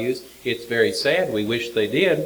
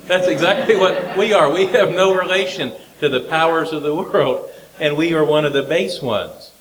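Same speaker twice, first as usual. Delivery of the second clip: louder, higher, driving the point home; emphatic declaration; 5 semitones higher, 4 dB louder than usual. Real, not acted. Preacher to congregation, rising emphatic and loud. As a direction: hammer the lesson home.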